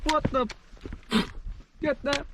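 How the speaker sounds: high startled yelp; surprised. Real, not acted. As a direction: caught off guard, pitch jumps up. Startled by ants mid-walk.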